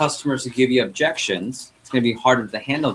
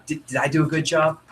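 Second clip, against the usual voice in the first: higher, tentative question with a stammered start; new-salesperson act; 5 semitones higher than usual. Shown as a demonstration. New salesperson asks a customer for feedback, high and tentative. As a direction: play the unsure beginner fishing for approval. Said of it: "You're almost emphasizing that you're new".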